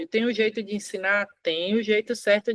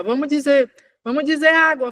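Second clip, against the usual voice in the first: higher, louder coaxing mom voice; coaxing child to speak; 4 semitones higher, 4 dB louder than usual. Shown as a demonstration. Mom coaxing her child to say água, higher and louder. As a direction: urge the child to say água, lifting the voice. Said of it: "ó".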